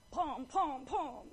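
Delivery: imitated heavy footfalls; march in with spaced vocal thuds; playful "pom" stomps; stomping noises. Imitating the lion's heavy steps.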